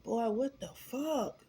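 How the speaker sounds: high, slow, drawn-out what the fuck; mock stunned outrage; retold disbelief. Retorts as she's thrown in car.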